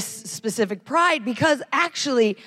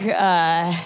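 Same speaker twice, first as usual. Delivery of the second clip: ordinary delivery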